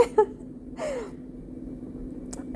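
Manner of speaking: high airy laugh-sob; real crying laugh; tearful laugh. Real, not acted.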